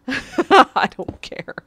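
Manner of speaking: laughing. Real, not acted. Streamer laughing as he tells viewers he doesn't care. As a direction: laugh out the words defiantly.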